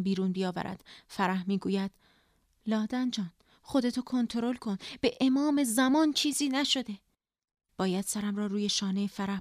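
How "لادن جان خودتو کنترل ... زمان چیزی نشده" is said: rising into urgent, higher insistence; pleading reassurance. A narrator's reading. Sister swearing to distraught Ladan nothing happened, urgent pleading. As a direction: plead with her to keep control.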